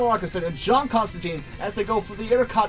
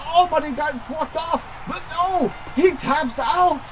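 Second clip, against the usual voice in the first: wrestling commentator; 6 semitones higher than usual. Acted. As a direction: call the finish like a hyped announcer. Live wrestling play-by-play.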